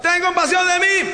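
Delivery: acted yell for attention; shouting. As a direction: call out like a blind beggar over a crowd. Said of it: "empezó a gritar".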